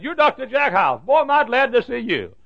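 high, excited mock greeting; loud-ish, high-pitched delighted recognition; imitated gushing fan. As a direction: gush like a thrilled fan spotting someone. Eager stranger recognizes him on plane.

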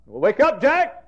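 loud, high wake-up cry; urgent call. Retold or imitated. Holy Spirit rousing the preacher at night, loud high call. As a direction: shout him awake, high and loud. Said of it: "the Holy Spirit came down and said".

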